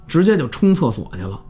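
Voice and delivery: plain reading voice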